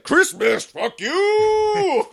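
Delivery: loud, very high hammed-up shout; mock furious yell. Robber answering a Christmas plea, mock defiant yell. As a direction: stretch the last word into a high yell.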